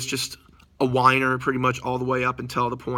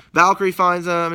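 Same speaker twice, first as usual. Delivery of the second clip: usual speaking voice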